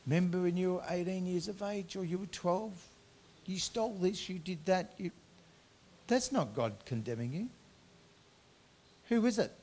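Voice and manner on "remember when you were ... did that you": a higher, lightly voiced accuser in the head; accusing inner voice. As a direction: taunt like a nagging voice of guilt. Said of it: "you hear this voice go".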